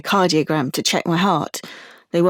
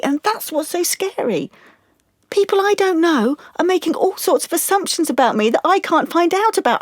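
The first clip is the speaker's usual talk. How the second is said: high, strained outpouring close to tears; distressed and scared; 8 semitones higher than usual. Acted. Client telling therapist about the shared photo and losing drawing, scared and distraught. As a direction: let the voice climb as the distress takes over. That's what's so scary.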